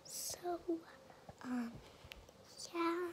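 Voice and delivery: breathy secret-telling whisper. Real, not acted. Whispering to camera throughout.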